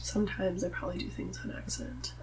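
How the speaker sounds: whispered through a laugh; giggling half-whispered confession. Real, not acted. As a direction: admit it sheepishly, laughing under breath.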